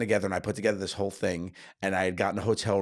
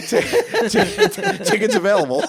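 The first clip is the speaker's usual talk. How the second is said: high, loud, stuttering through laughter; laughing too hard; 7 dB louder than usual. Real, not acted. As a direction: try to get it out while cracking up. Cracking up at the joke.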